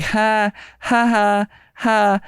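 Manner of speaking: high, spaced-out mock laughing; imitated laugh. Imitating the woman in the car, mock laugh. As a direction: mimic her held, separate ha's. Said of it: "I see her like".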